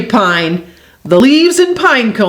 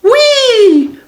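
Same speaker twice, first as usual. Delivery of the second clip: high, long squeal of fun; acted playful cry; gleeful whee; 3 dB louder than usual. A narrator's reading. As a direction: squeal it as if rolling downhill. Beaver rolls down the hill.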